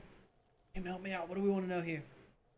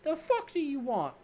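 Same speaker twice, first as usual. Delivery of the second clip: put-on bratty kid Kuno; 10 semitones higher than usual. Cocky kid mocking the cop, in a high bratty voice. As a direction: sneer it like a cocky street kid.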